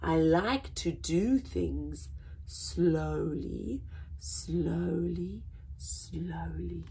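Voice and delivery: x0.6 slow, fading to a whisper; sleepy sloth whisper; slow yawning sloth. Acted. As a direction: drift off sleepily. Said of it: "the sloth yawned and said".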